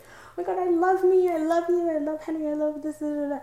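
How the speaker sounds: high-pitched gushing mock rapture; singsong high, giddy imitation; imitated euphoria. Retelling euphoric moment on shrooms.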